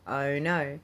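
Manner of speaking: ordinary delivery, nothing marked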